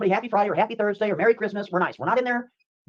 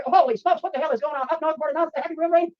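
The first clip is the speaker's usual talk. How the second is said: ordinary delivery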